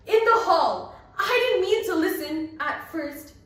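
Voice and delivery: voiced young Bernice, a touch strained; defensive girl. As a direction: blurt it out, caught listening. Bernice confronts Marjorie.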